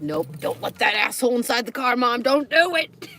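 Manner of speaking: the dog scolding his owner, mock-protective; voicing the dog. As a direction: give the dog a bossy voice. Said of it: "It's like".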